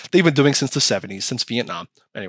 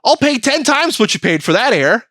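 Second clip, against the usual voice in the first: high, eager mock buyer; 10 semitones higher, 3 dB louder than usual. Buyer in India eagerly agreeing to overpay, high and eager. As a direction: jump at the deal in a high voice. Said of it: "some guy in India went".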